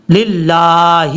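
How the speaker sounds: 'للہ' with long laa; correct recitation. Shown as a demonstration. Teacher finishes the model word, measured correct recitation. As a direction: draw out 'للہ', ending on a clear hi. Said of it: "اس کا پڑھنے کا طریقہ کیا ہے".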